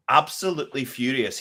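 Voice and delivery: ordinary talk, nothing marked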